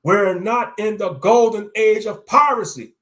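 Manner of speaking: fed-up demanding shout. Acted. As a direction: insist hard, nearly shouting. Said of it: "I demanded".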